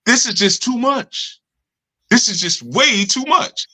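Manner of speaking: mock overwhelmed student. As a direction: drag it out like it's all too much.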